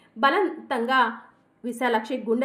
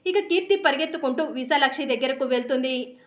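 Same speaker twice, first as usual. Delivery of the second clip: ordinary delivery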